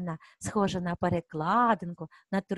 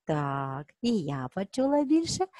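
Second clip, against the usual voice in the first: normal talking voice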